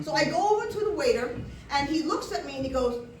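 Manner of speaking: normal talking voice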